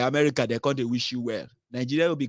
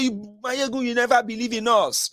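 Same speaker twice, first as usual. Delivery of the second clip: mock taunt; 9 semitones higher than usual. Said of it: "I say".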